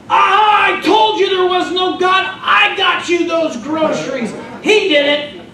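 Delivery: high, loud triumphant yell; mocking atheist catching her out; gloating neighbor shout. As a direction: pop out of the bushes and crow.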